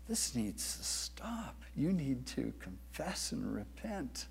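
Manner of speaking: soft, unhurried loving correction; gentle rebuke. Acted. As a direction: confront gently, barely above a murmur.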